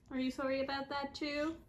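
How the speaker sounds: ordinary talk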